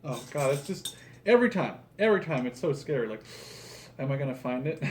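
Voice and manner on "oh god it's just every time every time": ordinary talk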